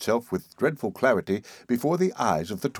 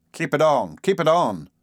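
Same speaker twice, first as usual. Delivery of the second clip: hurried; 7 semitones higher, 5 dB louder than usual. A narrator's reading. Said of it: "said Mister Button hurriedly".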